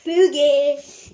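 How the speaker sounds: loud gleeful shout. Real, not acted. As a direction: yell it out with glee. Child shouts the word.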